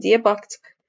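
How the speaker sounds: plain reading voice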